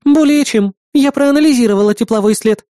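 high-pitched, certain female assistant; assuring assistant. A narrator's reading. Assistant confirms her analysis to doubting hero, high assured reply. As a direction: assure him in a high, firm voice. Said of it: "заверила помощница".

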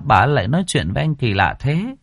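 plain reading voice

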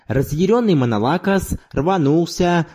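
plain reading voice

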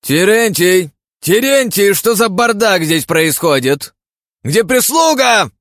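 high, loud irritated yelling; angry shout. Acted. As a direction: bark for the servants. Sleepy nobleman yells at household.

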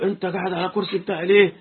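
angry Egyptian man shouting over his seat; retold yelling. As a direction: yell about his stolen chair, partly acted. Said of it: "الراجل المصري زعق له".

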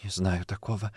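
hushed, soft denial; trembling whisper. Acted. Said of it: "прошептала она, но голос дрожал".

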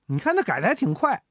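plain reading voice

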